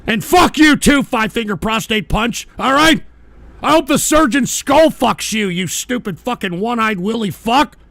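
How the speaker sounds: loud bursts easing slightly; angry cursing. Real, not acted. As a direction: lash out at a heckler. Cursing a named chatter.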